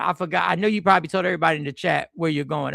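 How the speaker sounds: usual speaking voice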